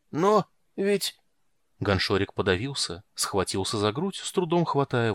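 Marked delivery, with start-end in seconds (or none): 0.1-1.2 high nervous clerk. A narrator's reading. Clerk chokes.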